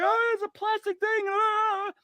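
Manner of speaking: mock freak-out over a plastic thing; mock panic. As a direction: imitate a flustered player losing it. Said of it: "and they're like".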